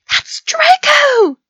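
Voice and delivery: very high, drawn-out cheer; thrilled high-pitched yell; excited shout. A narrator's reading. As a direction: squeal it out loud and high. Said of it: "Pansy said excitedly".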